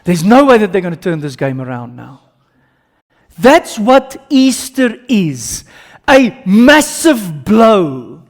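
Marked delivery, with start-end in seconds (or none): 3.4-8.2 loud, high, drawn-out preaching; fiery preaching. Acted. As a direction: hammer the victory home from the pulpit. Preaching at full force.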